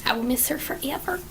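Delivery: soft real whisper of fond longing; whispered gush. Real, not acted. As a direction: breathe it out like a confession. Gushing over a lost character.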